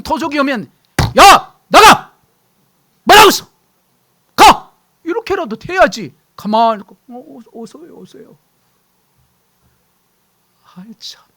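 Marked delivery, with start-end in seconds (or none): 1.2-4.7 loud high yell to drive off a thief; a sudden loud shout of get out; shouting at an intruder. Acted. Chasing off a thief. 7.1-8.4 much softer, meek stammered greeting; hushed nervous welcome to an intruder; timid welcome. Acted. Meekly welcoming the thief. 10.7-11.2 whispered sigh. Acted. Sighing aside after the scene.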